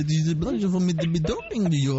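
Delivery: thick put-on French accent. Host charming a delighted caller, French accent. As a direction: play the courteous Frenchman in mock French. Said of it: "I love your French accent".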